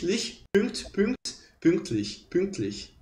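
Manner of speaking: "pünktlich" with lips rounded for U while saying I. Shown as a demonstration. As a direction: build up "Pün, pünkt, pünktlich" slowly. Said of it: "só fazer lich".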